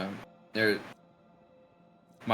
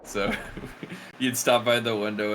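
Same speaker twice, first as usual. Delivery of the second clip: ordinary talk, nothing marked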